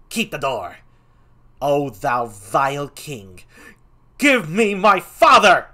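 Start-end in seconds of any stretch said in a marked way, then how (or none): 1.6-5.7 higher and much louder, furious; raging Laertes. Acted. Laertes raging at the king over his father, furious. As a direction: hurl it at the king.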